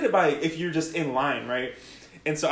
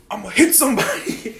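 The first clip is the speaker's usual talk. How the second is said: mildly raised, half-laughing threat; mock threat; 6 semitones higher, 4 dB louder than usual. Big kid to opponents before kickoff, a mock tough threat. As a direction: play the angry big kid, light and laughing.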